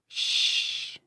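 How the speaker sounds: hushed, breathy shush; calm him with a whispered shh; soft shush. A narrator's reading. Mother soothing her crying son, soft shush. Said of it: "she said".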